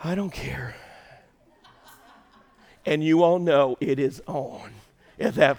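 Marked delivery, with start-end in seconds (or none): 0.0-0.8 soft, lower, mock-sweet southern reply; a wife answering her husband sweetly, lightly imitated. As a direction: coo it like a charming southern wife. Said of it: "in that sweet Southern charming voice".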